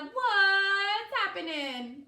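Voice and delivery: big slow booming hello with a laugh in it; a laughing, drawn-out holler; loud hearty greeting. Acted. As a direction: slap him on the back with your voice. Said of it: "and a loud".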